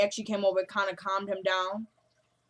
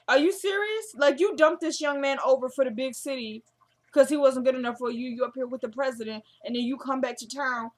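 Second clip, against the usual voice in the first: higher, exasperated scolding; indignant disbelief; 5 semitones higher, 3 dB louder than usual. Real, not acted. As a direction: call her out, incredulous, pitch raised.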